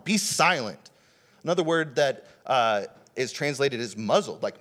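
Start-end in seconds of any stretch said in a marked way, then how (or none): 0.0-0.9 raised-pitch emphatic retold order; sharp command. Jesus commanding the storm, sharp and raised. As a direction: command it with force, pitch lifted. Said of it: "is Jesus sharply saying".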